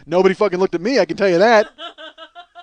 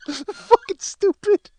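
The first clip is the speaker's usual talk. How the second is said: high, laughing disbelief; cracked up at his own stunt; laughing at himself; 11 semitones higher than usual. Real, not acted. Laughing at his own story, high giggling disbelief. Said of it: "I'm fucking hysterical".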